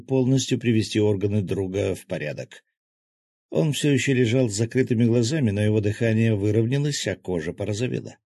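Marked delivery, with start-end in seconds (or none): none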